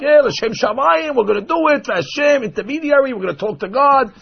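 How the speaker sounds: people justifying the idol. Retold or imitated. The people justifying the calf, in a mock eager voice.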